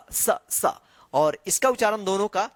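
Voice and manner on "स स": "स" said as a sharp s with the tongue between the teeth; dental s sound. Shown as a demonstration. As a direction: hiss "स" through the teeth. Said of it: "मेरा जीभ आ रहा है स". (दांतों के बीच से "स")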